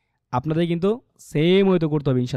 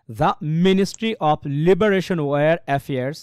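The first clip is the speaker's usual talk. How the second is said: slow, stress on Liberation and Affairs; stressed reading; 4 dB louder, much slower than usual. Shown as a demonstration. Teacher reading the sentence for students. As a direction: push the stressed words slowly. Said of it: "তো কেমন হচ্ছে দেখেন".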